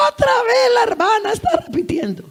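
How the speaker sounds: giggling high mock voice of a gossip; gossiping church member. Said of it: "mocking gossip quote".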